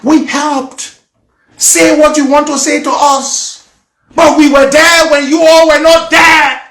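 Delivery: loud, high, impassioned protest; very emotional. Real, not acted. Telling those who were not there what they went through, very emotional. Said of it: "I'm sorry I became very emotional".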